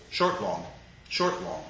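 short unstressed "Short", then weightier "long"; iambic stress. Shown as a demonstration. Lecturer showing students an iamb, "Short, long" in iambic rhythm. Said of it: "unaccented syllable followed by an accented syllable".

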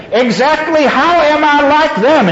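high-pitched indignant demand. A narrator's reading. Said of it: "she demanded".

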